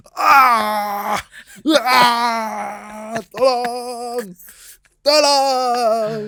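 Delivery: long, high, drawn-out cry for help; mock agonised wail. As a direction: wail it out in hammed-up despair. Mock agony at dad's cringe accent.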